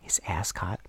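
hushed whisper aside. Real, not acted. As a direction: breathe it under your breath. Aside in a movie chat.

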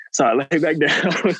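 chuckling through the words; laughing. Real, not acted. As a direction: laugh as you say it. Laughs while telling.

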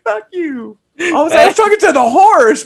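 high, loud mock retort; imitating characters. Retelling the horse scene to his cohost, high and loud. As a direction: squeal the lines through laughter. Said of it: "She's like".

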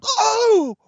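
very high squeaked oh; a high strangled yelp of shock; speechless gasp. Retold or imitated. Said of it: "words didn't come out. I just went".

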